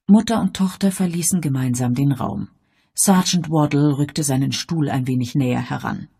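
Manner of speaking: plain reading voice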